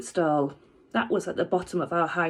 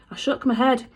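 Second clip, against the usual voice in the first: plain reading voice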